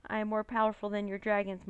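ordinary delivery, nothing marked

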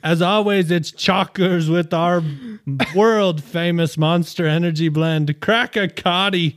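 put-on Aussie ad read; best Australian accent. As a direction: read the slogan with a hammed-up Aussie twang. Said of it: "Best Australian accent?".

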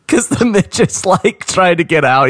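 high, laughing through the words; giggling as you picture him stuck; real laughter breaking into the line. Real, not acted. Laughing at the trapped little guy.

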